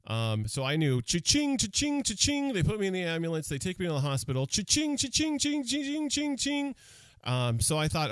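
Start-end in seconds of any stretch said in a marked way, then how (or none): 1.0-2.5 high, sing-song mock till ring; mocking the bills piling up with a high cha-ching; cash register cha-ching. Mocking ambulance costs. 4.5-6.8 high, sing-song mock till ring; mocking the bills piling up with a high cha-ching; cash register cha-ching. Mocking hospital costs.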